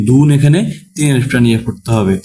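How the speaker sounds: ordinary talk, nothing marked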